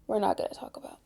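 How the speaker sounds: hushed whisper, dodging the subject; whispered aside. Real, not acted. Student whispering to viewers to skip math class.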